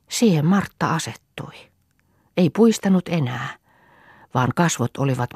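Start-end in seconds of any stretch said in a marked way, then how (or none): none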